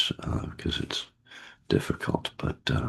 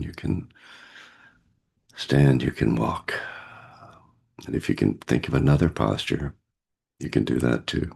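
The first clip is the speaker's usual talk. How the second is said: guided meditation. Acted. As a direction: gently guide them into stillness.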